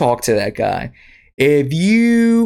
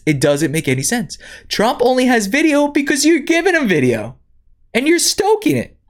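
exasperated; 11 semitones higher than usual. Real, not acted. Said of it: "exasperated rant".

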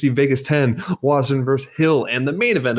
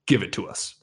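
demanding; 3 semitones lower, 5 dB quieter than usual. Real, not acted. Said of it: "that was a little demanding at the end".

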